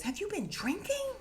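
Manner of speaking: very high, rising suspicious question; acted questioning cop. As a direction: act out an officer's suspicion. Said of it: "here go my acting".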